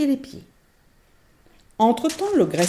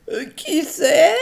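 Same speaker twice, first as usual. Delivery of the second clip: higher, slow put-on old voice; faked granny; 11 semitones higher, 5 dB louder, much slower than usual. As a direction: try again as granny. L'ogresse corrige sa voix. (fausse voix de mémé)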